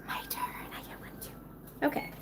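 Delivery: hushed, unvoiced aside; whispering. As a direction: whisper it to yourself.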